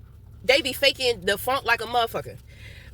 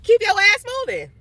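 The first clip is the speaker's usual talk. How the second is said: high loud laughing dismissal; loud laughing retort; 9 semitones higher, 5 dB louder than usual. Real, not acted. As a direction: shout it high through laughter.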